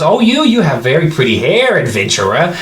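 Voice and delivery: high, swooping goblin flattery; goblin peddler. Acted. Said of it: "He goes".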